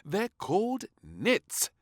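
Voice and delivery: high, slow, firm correction; a firm high maid; firmly. A narrator's reading. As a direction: put her right firmly. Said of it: "said the maid firmly".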